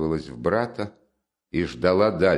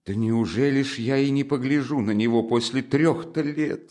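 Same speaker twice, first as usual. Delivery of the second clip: high, plaintive mother's voice; lightly acted tearful protest; 6 semitones higher than usual. Mother longing to look at her son, tearfully. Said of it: "заплакала Пульхерия Александровна".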